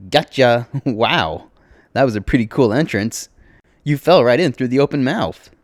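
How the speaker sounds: cheerful, lively greeting to a newcomer. A narrator's reading. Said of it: "lady says cheerfully".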